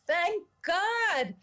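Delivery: retold relieved scream. Dentist on hearing there's a pulse, retold as a high scream. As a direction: imitate a scream of relief by raising the pitch. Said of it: "And the dentist screamed".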